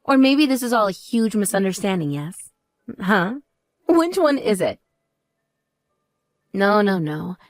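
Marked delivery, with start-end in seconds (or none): none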